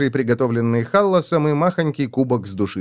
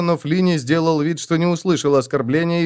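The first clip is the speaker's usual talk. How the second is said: ordinary delivery, nothing marked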